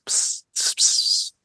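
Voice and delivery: eerie hissed whispering; voiceless sibilant whisper noises. Acted. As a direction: hiss like unseen whispering spirits. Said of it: "you hear whispers".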